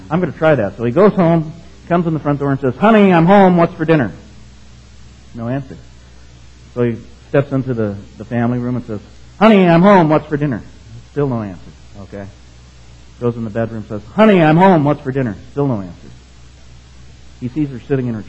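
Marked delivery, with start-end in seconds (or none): 2.7-4.1 loud, high call through the house. Acted. Acted husband calling through the house. 9.4-10.6 loud, high opening that settles down; husband calling to his wife in another room. Acted. Acted husband calling from family room. 14.1-15.3 raised, high greeting, easing at the end; calling out. Acted. Acted husband calling from the bedroom.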